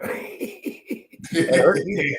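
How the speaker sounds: words squeezed out through laughter; laughing. Real, not acted. Cracking up at his own story with friend, laughing. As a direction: crack up while saying it.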